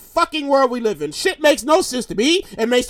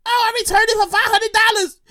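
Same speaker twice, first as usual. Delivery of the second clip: lightly voiced woman, pitched up; finder's voice; 6 semitones higher than usual. Woman telling family the reward, high-voiced. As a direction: answer in her higher voice.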